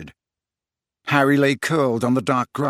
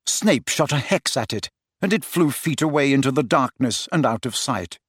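plain reading voice

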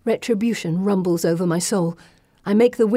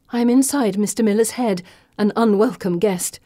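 ordinary delivery, nothing marked

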